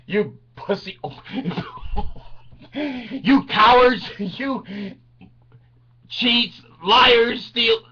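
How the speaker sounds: high-pitched insults over a screaming crowd; mock yelling. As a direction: hurl them out in a comic mock yell. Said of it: "to be heard above the others screaming".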